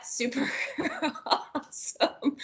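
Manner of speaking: breaking into real giggles mid-phrase; soft, high words dissolving into laughter; laughing. Real, not acted. Speaker signing off to colleagues after the giggles, laughing.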